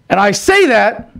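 usual speaking voice

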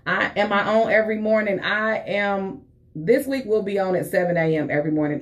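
ordinary talk

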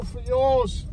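ordinary delivery

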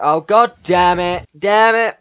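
loud, high, drawn-out cursing; exasperated shout. Real, not acted. Player curses a character's death, exasperated shout. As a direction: curse loudly at losing.

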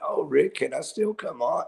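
high, pleading mock voice; mock begging. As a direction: beg to be let on, hammed-up. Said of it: "begging for mercy, going".

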